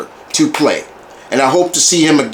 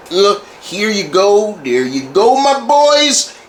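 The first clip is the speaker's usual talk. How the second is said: gracious presenter; 5 semitones higher, slower than usual. Retold or imitated.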